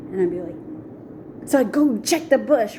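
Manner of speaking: ordinary delivery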